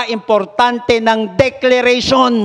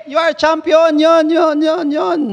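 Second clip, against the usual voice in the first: echo; 7 semitones higher, 3 dB louder than usual. Acted. Echo of champion.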